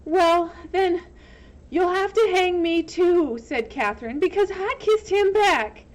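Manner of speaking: high loud defiance; defiant. Acted. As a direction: stand your ground. Defiant to sheriff.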